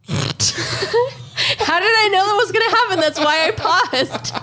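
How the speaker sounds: high laughing, near tears. Real, not acted. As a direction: laugh helplessly through the words. Laughing through words.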